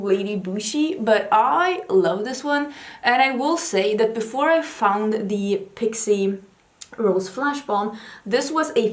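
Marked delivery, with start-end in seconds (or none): none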